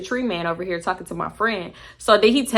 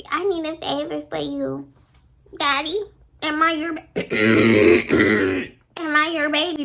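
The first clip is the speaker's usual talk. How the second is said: fluffy sweet-talk; 5 semitones higher than usual. Retold or imitated. Friend cooing to her man for a favor, mock sweet-talk. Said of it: "She went from tough to fluff".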